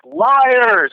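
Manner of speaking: high, drawn-out mock accusation; mock crowd outcry. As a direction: cry it out like an outraged crowd. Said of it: "the women were like".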